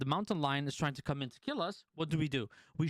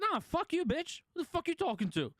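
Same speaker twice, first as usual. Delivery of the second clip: mock comeback; 12 semitones higher than usual. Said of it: "I was like".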